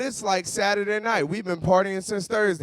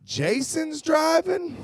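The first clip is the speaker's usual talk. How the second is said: alarmed; 9 semitones higher, 4 dB quieter, slower than usual. Pretend. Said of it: "you're like".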